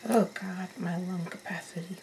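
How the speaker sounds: ordinary delivery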